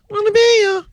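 very high falsetto hook. Acted. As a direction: croon the lyric in falsetto. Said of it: "in [name]'s voice".

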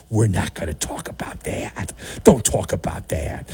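whispered, hushed cover-up; conspiratorial whisper; mock hush. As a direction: whisper it like a secret to bury. Hushing up the interceptions.

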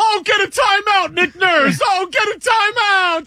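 high-pitched, shouty sportscaster impression; Dick Vitale. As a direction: holler it high, stretching the last words. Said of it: "doing my Dick Vitale".

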